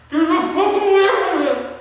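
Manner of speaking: high, loud desperate yell; screaming rage at the creature; furious scream. Acted. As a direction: scream to drive the monster off.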